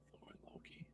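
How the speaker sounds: awed breathless whisper. Real, not acted. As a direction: whisper the names in awe. Awed repeat of the announcement.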